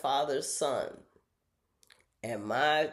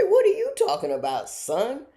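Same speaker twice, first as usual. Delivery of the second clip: high, loud retort; mock indignant mother; 13 semitones higher, 9 dB louder than usual. Mom answering her son's accusation, indignant and loud. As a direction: throw the challenge back in acted outrage. Retold quarrel with her son.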